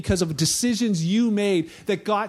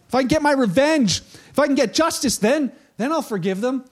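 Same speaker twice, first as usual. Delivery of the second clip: higher, louder mock demand for payback; vengeful bargaining; 6 semitones higher, 4 dB louder than usual. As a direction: act out someone insisting on getting even first. Acts out someone wanting revenge.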